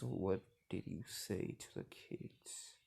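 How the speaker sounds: slow, whispery mock-stern question; imitated mother's ominous near-whisper; hushed confrontation. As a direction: draw it out low under the breath. Mother confronts son, low slow.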